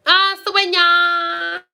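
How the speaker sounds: high, loud, drawn-out cry; loud call. Real, not acted. Loud drawn-out call, then quiet repeat.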